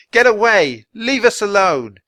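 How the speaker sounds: high, loud furious yell; boy angrily shouting at two wolves; angry shout. A narrator's reading. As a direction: glare and shout them off. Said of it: "He glared angrily".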